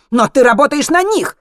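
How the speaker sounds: high, loud angry comeback; shouted accusation. A narrator's reading. Zlotan accuses Henry of working for them, shouting. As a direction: throw the accusation in his face.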